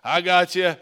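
loud, breezy mock God voice, easygoing; a loud, cheerfully reassuring God; casual God. Pastor voicing God, loud and casually reassuring. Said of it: "you think it's gonna be like".

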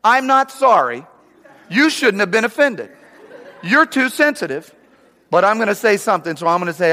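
high, loud, laughing rant; blunt honest rant. Retold or imitated. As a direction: let the real meaning burst out. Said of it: "What that means is".

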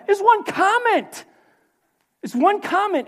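very high-pitched, exasperated exclamation; incredulous outcry. Real, not acted. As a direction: cry out in disbelief at such overreaction. Exclaims at Elijah's overreaction.

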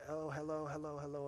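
a hint of bright locker-room greeters; friendly greeting. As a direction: gym regulars greeting Elder Kim, lightly voiced. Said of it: "and all this stuff".

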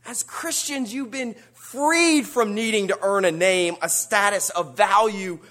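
high, ringing pulpit preaching; preaching at full force. Acted.

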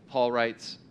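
ordinary delivery

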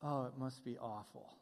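low, quiet mock sympathy; sarcastic sympathy. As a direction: dryly play down the complaint, pitched well down. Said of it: "one form of sarcasm, until her dad".

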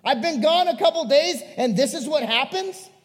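high, loud indignant outburst; intense, frustrated Jesus. Acted. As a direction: throw it at them, fed up. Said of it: "he would have that intensity".

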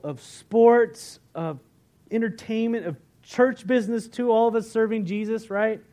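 loud, fired-up sermon delivery; preaching. Acted. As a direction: preach it out to the congregation. Sermon preaching at full force.

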